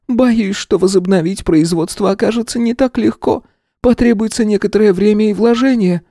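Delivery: high, strained woman's report; a distressed manager explaining; worried director. A narrator's reading. (встревоженная директриса)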